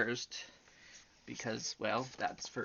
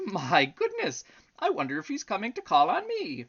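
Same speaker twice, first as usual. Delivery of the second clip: brisk, rising excited wondering; excited elephant; 12 semitones higher, 10 dB louder than usual. A narrator's reading. Elephant wondering about his visitor, excited. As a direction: voice the elephant's eager surprise.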